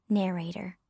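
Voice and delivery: ordinary delivery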